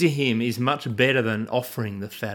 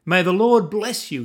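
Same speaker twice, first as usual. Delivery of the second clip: bright, higher and louder hello; cheerful greeting; 6 semitones higher, 5 dB louder than usual. A narrator's reading. Saul cheerfully greeting Samuel, higher and louder. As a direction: greet the prophet with put-on cheer. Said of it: "Saul greeted him cheerfully".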